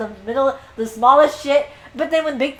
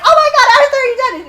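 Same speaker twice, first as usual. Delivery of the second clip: high, loud, hammed-up shock; imitated high-pitched horrified outcry; mock alarm; 10 dB louder, faster than usual. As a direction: cry out in fake panic over a body. Mock alarm at pretend death.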